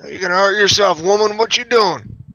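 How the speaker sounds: high, loud scolding call; scolding shout. Acted. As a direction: shout a warning at her. Scolding companion in character.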